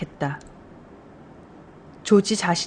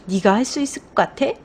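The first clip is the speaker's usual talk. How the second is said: mildly mocking question, pitched up at the end; sarcastic doubt; 3 semitones higher than usual. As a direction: scoff lightly, as if doubting them. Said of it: "빈정대면서".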